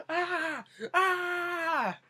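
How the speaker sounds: high imitated scream of panic; mock scream. Grandpa surrounded by zombies in his van, mock-terrified scream. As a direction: scream as if cornered, hammed-up. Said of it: "they're all surrounding him. He's like".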